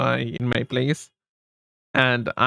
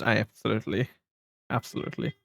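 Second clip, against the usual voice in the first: fading to whisper; 3 semitones lower, 7 dB quieter, faster than usual. Real, not acted.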